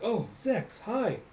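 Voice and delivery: high, lilting little hello; mock surprised greeting. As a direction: chirp it as an imitated greeting.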